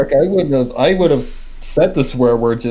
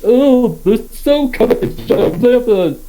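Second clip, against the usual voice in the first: higher, lightly voiced sneering aristocrat; snooty rich boy; 6 semitones higher than usual. Still mocking Blue Blood as a snooty rich boy.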